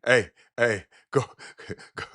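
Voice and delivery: ordinary talk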